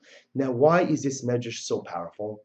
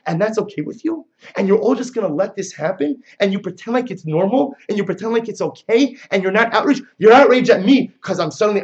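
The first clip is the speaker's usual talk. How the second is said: impassioned accusing bride, voice raised and pitched up; outraged bride; 6 semitones higher, 5 dB louder than usual. Acted. Bride berating the wedding guests, raised outraged voice. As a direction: hurl it at the guests like a wronged bride. Voicing Chana's outraged speech.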